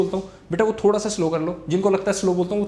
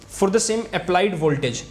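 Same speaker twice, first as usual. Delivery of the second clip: slow dictation; much slower than usual. Shown as a demonstration. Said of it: "dictating from the book".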